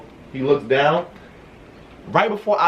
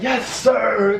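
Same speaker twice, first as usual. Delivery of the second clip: "Yes, sir" much higher and bright with joy; excited yes sir; 9 semitones higher than usual. Retold or imitated. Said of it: "I was like, oh no".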